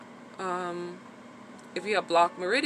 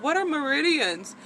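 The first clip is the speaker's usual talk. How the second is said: higher, mock clueless question; puzzled self-quote; 8 semitones higher, 4 dB louder than usual. Asking the acupuncturist what meridians are, higher puzzled tone. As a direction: sound honestly lost at the word. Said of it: "I'm like".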